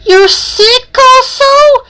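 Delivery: high, slow, drawn-out question; concerned question. Acted. Kate hears Conroy is sick.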